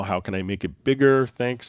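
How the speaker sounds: usual speaking voice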